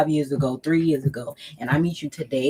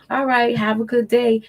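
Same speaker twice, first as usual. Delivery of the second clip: higher, cheerful polite goodbye; nice tone; 7 semitones higher, 4 dB louder than usual. Shown as a demonstration. Ending the call with an ex, higher, cheerful sweet goodbye. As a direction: sign off sweetly, no hard feelings. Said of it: "in a slow, nice tone".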